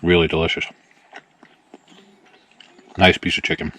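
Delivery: talking while chewing; mouth full. Real, not acted. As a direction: speak through a mouthful of chicken. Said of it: "talking with his mouth full".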